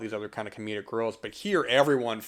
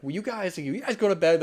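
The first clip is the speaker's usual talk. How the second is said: high-pitched retold plea to the kids; imitating Michelle; 8 semitones higher, 4 dB louder than usual. Michelle to the guys in the basement, imitated in a high voice. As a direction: raise the pitch sharply, quoting her. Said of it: "just the way she says it".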